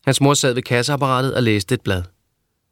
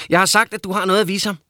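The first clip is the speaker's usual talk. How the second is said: higher, teasing character voice; lightly voiced higher teasing boy; Peckerhead's voice; 8 semitones higher, faster than usual. A narrator's reading. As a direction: pitch him up and tease.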